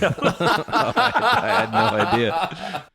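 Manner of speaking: high, laughing through the words. Real, not acted. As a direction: talk while cracking up. Laughing at the letter.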